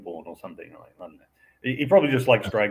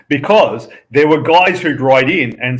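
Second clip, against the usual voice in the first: mock pedant; 5 semitones higher, 10 dB louder than usual. Mocking pedantic fans writing in.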